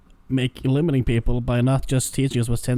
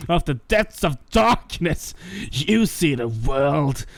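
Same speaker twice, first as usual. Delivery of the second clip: edgy villain rant; 3 semitones higher, slower than usual. Acted. Friends recording a show, reading the edgy villain's rant, menacing then shouting. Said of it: "regale us with the edgy rant".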